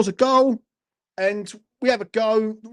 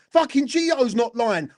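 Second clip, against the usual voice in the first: ordinary talk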